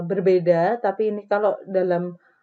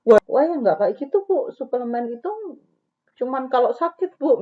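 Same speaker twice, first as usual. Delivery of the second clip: loud, confronting correction; confronting counselor; 5 semitones higher, 4 dB louder than usual. Shown as a demonstration. Counselor correcting a mother about supplements, confronting. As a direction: flatly contradict the client. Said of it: "misalnya nggak kayak gitu".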